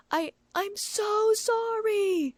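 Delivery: high, drawn-out frightened cry; panicked scream. A narrator's reading. The transformed monkey screaming an apology in panic. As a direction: wail it in panic, slowly. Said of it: "the monkey screamed".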